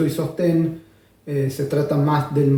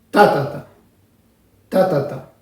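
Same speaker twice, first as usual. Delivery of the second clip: first ta hit harder than the two after; accent on first ta. Shown as a demonstration. Teacher replacing the word with syllables to show the accent. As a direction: sound out the rhythm, first beat strong. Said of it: "si yo lo digo con otros sonidos".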